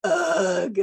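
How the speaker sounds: put-on loud, high, fed-up teenage "ugh"; exasperated teenage groan; teen's disgusted groan. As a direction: groan like a bored fifteen-year-old. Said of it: "he's gonna be like".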